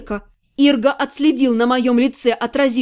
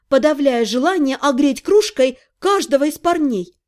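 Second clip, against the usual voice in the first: usual speaking voice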